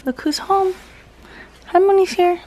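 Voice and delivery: high excited greeting; bright hello at the door; welcoming someone home. Real, not acted. Greeting grandma with the puppy.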